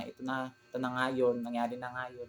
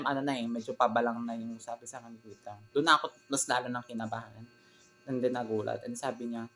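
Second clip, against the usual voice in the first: ordinary talk, nothing marked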